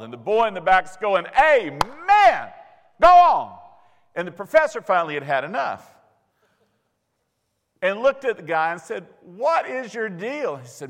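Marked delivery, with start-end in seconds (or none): 1.4-3.6 loud, high revival shout; shouted amen. Retold or imitated. The student in the back hollers at the professor in a loud high amen. Student cheers louder each time. 9.2-10.6 exasperated question. Retold or imitated. Professor exasperated with student.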